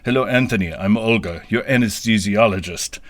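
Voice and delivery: lightly imitating a Russian anesthesiologist; light Russian accent. Anesthesiologist greeting patient on gurney, lightly mock Russian accent. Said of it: "said in a heavy Russian accent".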